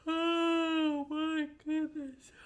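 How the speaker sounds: high yawning "Oh, my goodness", slow; real yawn. Real, not acted. Teacher caught in a big Monday morning yawn, high. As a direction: yawn the words out slowly. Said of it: "that's a Monday morning yawn".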